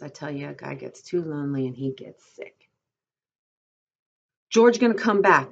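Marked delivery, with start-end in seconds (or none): 4.5-5.5 high, loud, anxious self-reassurance; worried, raised voice; frightened voice. A narrator's reading. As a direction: reassure yourself while frightened. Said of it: "in a frightened voice".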